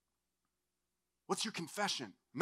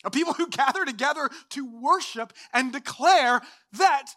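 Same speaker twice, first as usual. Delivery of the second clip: ordinary talk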